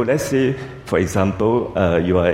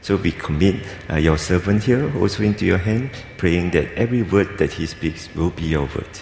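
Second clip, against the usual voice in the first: prayer; 4 semitones lower than usual. Acted. Leads congregation in prayer.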